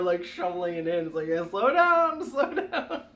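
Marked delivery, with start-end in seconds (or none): 1.3-3.0 high, loud retold plea with a laugh; half-laughing, high-pitched alarm; mock urgent caution. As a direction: play a parent amusedly reining in a kid. Parent reining in eager child, retold.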